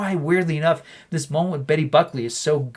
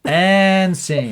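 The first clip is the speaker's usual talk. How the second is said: theatrical announcement; 4 semitones higher, 7 dB louder than usual. Pretend. Ending the acted scene.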